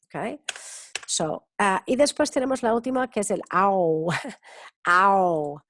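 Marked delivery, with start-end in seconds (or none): 3.5-5.6 "au" as a drawn-out ow of pain; "au" gliding from ah to oo, held long; pain-cry "au" vowel. Shown as a demonstration. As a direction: stretch "au" like the cry when you get hurt. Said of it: "Cuando te haces daño, dices, au".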